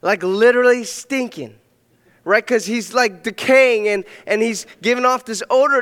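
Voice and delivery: full preaching. Acted. Pastor to his congregation, preaching at full force. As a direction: preach it out to the whole room.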